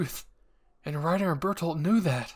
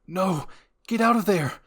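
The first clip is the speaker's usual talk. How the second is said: panicked warning; 5 semitones higher, 8 dB louder than usual. A narrator's reading.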